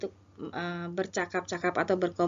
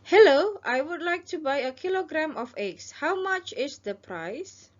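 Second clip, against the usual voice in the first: a lightly voiced shopper, slow and bright; customer role; 8 semitones higher, 4 dB louder, slower than usual. Shown as a demonstration. Teacher playing a shopper asking the egg seller. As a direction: act out the customer asking the price. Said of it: "ini adalah Anda dan ini adalah penjual".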